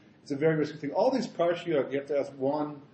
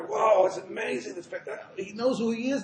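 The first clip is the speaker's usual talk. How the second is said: higher, mock awestruck murmurs; amazed followers; 6 semitones higher than usual. Chassidim reacting to the Rebbe, mock amazement. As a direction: imitate disciples lightly gasping in wonder. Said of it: "the Chassidim are all amazed".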